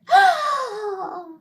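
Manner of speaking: high startled gasp; shocked gasp. Real, not acted. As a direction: gasp in fright. Child reacts to story.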